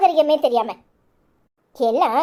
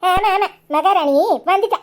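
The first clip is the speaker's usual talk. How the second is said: slow, drawn-out sneer with a higher pitch; sarcastic mockery; 3 semitones higher than usual. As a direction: drag out மகாராணி as a scornful jab. Wife mocks sister-in-law to husband. (கேலியான கிண்டல் குரல்)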